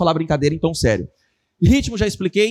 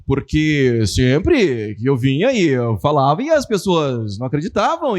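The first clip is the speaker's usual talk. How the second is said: lower, slow, measured philosopher's cadence; Mário Sérgio Cortella; 6 semitones lower, slower than usual. Retold or imitated. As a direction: drag the words out like a pondering philosopher. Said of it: "quem conversa assim? Mário Sérgio Cortella". (imitando o Cortella, grave e pausado)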